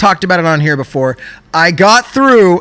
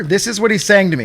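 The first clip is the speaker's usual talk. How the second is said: ordinary talk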